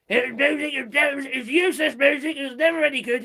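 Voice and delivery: lightly mock Trump's dismissive boast. Mocking Trump's view of [name], light put-on. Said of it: "don't ask me to do a Donald Trump accent".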